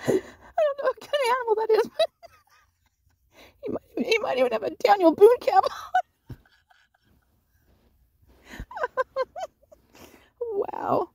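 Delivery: high, breathless crying laugh; words squeezed out through laughter. Real, not acted. Laughing at a funny photo.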